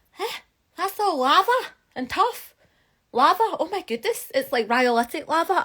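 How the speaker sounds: high-pitched mock disbelief; astonished reenactment. Geologist reliving finding lava in the mountains, astonished. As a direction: squeak it out, acting amazed at a discovery. Acts out her astonished discovery.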